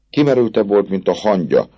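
plain reading voice

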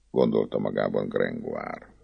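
ordinary delivery, nothing marked

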